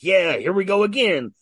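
loud, high mock cheer; excited reaction. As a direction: act out getting pumped for the action. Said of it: "I'm like".

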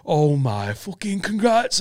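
imitating an overwhelmed mate, slowly; stunned congrats. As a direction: say it like you can't believe it. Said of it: "And he went".

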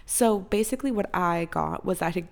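normal talking voice

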